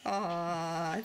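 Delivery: ordinary talk, nothing marked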